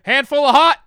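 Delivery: high, very loud order call; imitated yell. Taco Bell guy yelling to coworker for sauce, shouting. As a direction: holler it like a fast-food worker. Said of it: "He turns around and yells".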